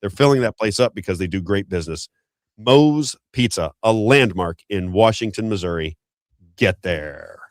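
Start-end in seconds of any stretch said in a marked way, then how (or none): 2.6-7.4 stretched, deliberate sponsor close; slow ad tag. Real, not acted. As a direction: land the tag line slowly. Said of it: "slow sponsor tag line".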